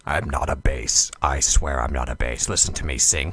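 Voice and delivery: teasing a co-host with a deep fake bass; mock deep bass. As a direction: growl it down like a singer proving he's a bass. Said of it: "Despite his speaking voice".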